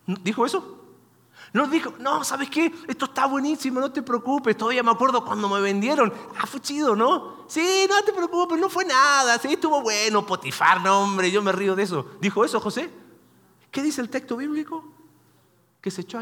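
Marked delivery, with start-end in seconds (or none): 2.0-12.0 high-pitched, hammed-up "no big deal"; mock breezy dismissal. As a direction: overdo the reassurance, pitched up and louder in bursts. Parodies Joseph gleefully minimizing his suffering.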